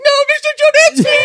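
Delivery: high squeaky pleading elf, shouted; Dobby voice. Retold or imitated. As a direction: squeal it like a frightened house-elf. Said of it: "Dobby's like".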